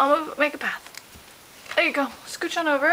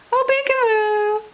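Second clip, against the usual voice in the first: peekaboo; 6 semitones higher, slower than usual. Pretend. Peekaboo game with baby.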